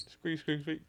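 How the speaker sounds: high squeaky mouse noise; little squeaks way up high. Acted.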